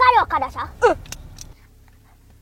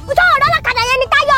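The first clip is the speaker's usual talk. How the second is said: scolding; 7 semitones higher, 3 dB louder than usual. Acted. Mother scolds son's driving.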